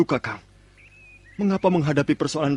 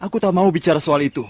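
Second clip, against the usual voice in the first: angry; 2 semitones higher, 4 dB louder than usual. Acted. Brothers arguing, younger refuses.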